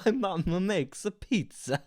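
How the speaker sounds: put-on slow, cheesy Italian accent; drawn-out Italian accent. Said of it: "Is that impression".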